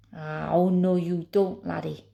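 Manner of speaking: loud, firm Scottish warning; Scottish accent. Acted. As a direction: warn him off in a Scottish accent. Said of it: "A Spoon again".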